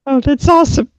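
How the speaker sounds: light up at the answer; delighted encouragement; enthusiastic. Real, not acted. Enthusing about dance music.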